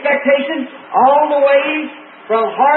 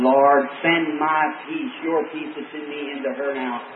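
soft, low, slow inward prayer; hushed plea to God for peace at home; silent prayer; 9 semitones lower, 6 dB quieter than usual. Retold or imitated. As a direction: pray quietly inside, slowly. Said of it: "down in my heart, I'm saying".

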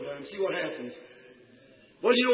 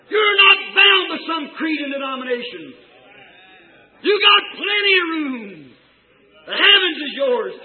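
high, loud rousing cry; screaming back; 7 semitones higher, 6 dB louder than usual. Acted. Mother eagle tells her child of its birthright, screaming back. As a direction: proclaim it loudly, stretching the words. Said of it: "She screamed back".

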